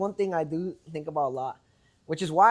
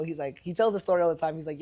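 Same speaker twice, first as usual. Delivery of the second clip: usual speaking voice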